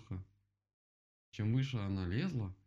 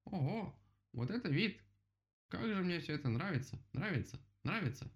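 high-pitched wonder; delighted admiration; 9 semitones higher than usual. A narrator's reading. Woodpecker looks round at the view, high delighted exclaiming. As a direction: marvel in a high voice.

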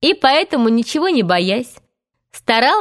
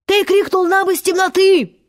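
high, put-on pirate voice; pirate confirming; 9 semitones higher than usual. A narrator's reading. Starkey backs up Smee to Hook, high insistent voice. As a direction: insist in a much higher character voice. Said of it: "подтвердил Старки".

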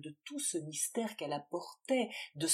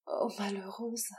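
despairing whisper; 2 semitones higher than usual. Acted. Valentine's despairing reply.

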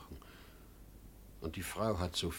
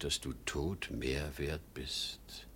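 slow, lower whisper; hushed bitter whisper; 5 semitones lower, 7 dB quieter, slower than usual. Acted. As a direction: let the truth sink in quietly. Father's bitter realization. (leise und bitter geflüstert)

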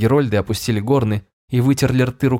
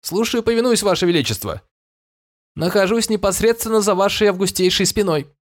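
high, obsequious servant voice; fawning servant at your back; 10 semitones higher than usual. A narrator's reading. Servant Osfer answers the king. (угодливый слуга)

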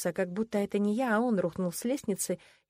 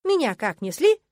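ordinary delivery

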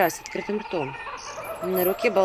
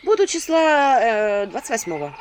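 a hint of offhand evasion; 4 semitones higher, 5 dB louder than usual. A narrator's reading. Witch dodges the request.

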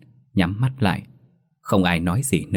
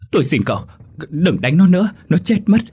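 high, loud desperate begging; tearful pleading; 6 semitones higher, 4 dB louder, faster than usual. A narrator's reading. Mother begs the thug to stop beating her son, desperate. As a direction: beg for the son's life through tears. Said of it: "khóc ré lên, lao lại ôm chân kẻ đó rồi van xin".

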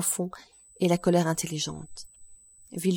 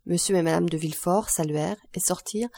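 usual speaking voice

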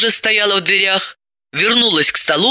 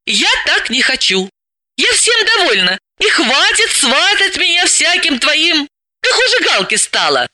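put-on higher girlish voice, arguing back; Dalila, young and stubborn; 11 semitones higher than usual. Dalila answers her bossy friend, higher and argumentative. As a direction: push back with a lively counter-argument.

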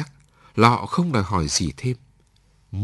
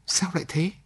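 shocked question; 10 semitones higher than usual. A narrator's reading. Man shocked by her request.

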